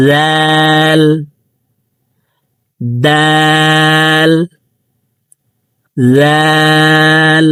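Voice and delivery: দাল and জাল held long on a steady tone; drawn-out letters. Shown as a demonstration. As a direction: stretch each letter name slowly. Teacher drawing out letter names.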